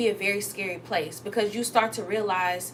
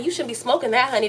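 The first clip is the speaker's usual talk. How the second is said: ordinary talk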